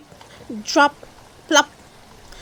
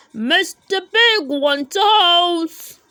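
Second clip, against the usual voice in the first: loud, slow, higher shout; shouting to friends; 7 semitones higher, 8 dB louder, slower than usual. A narrator's reading. Builder shouting to his friends, loud. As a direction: call it across the site. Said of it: "shouts to his friends".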